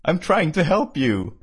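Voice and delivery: a touch of exasperation, drawn out; mildly frustrated. A narrator's reading. Monica tries helping the patient, mildly frustrated. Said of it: "sounding frustrated".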